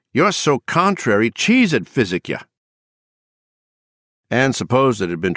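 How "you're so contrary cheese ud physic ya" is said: high, exasperated scolding mother. Acted. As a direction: cry it out, fed up with the child. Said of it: "cried at Anne in utter exasperation".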